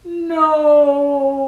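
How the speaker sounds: mild high, loud moan; a hammed-up little moan. A narrator's reading. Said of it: "moaned the fox".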